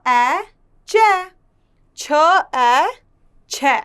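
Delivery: ए here said as an open ऐ, then the blend as in कै; ai vowel drill. Shown as a demonstration. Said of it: "इसको बोलते हैं ऐ". (ऐ की मात्रा का उच्चारण)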